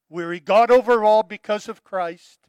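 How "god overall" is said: God overall proclaimed loud; loud proclamation. Real, not acted. Preacher restating his key sentence to the congregation, loud and proclaiming.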